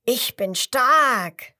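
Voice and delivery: high, loud, very slow boast; slow loud bragging call; second crocodile calling. A narrator's reading. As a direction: stretch it out like a proud strong beast. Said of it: "Das zweite ruft".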